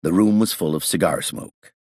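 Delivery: usual speaking voice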